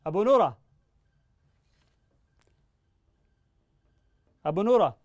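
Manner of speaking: loud, high call of the name. Real, not acted. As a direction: call the name over a bad phone line. Repeating name over bad line.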